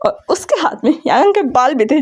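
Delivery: usual speaking voice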